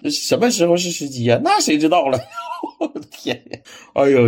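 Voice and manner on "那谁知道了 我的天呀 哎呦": laughing it out, voice jumping high; cracking up at the film; real giggles breaking through the words. Real, not acted. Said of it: "我就那个乐呀".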